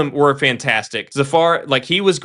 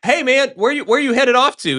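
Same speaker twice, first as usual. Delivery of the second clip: mock coworker call; 10 semitones higher, 3 dB louder than usual. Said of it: "is like".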